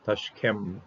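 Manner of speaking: ordinary delivery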